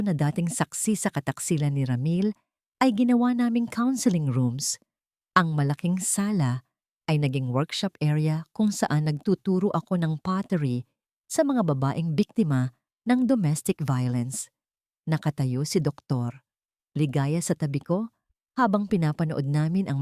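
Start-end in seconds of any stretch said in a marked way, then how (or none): none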